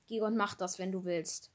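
ordinary delivery

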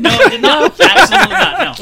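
high, loud laughing no; laughing, caught out; laughing denial. Real, not acted. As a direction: laugh out the answer. Laughing answer to the question.

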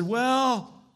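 high voice of a rough street man. Retold or imitated. Homeless man offers the preacher his coffee, voiced high. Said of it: "He said".

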